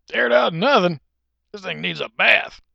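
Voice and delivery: high, loud acted indignation; indignant. Shopper rejecting the salesman's excuse, loud indignant retort.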